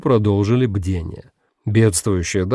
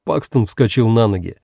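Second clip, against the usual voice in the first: usual speaking voice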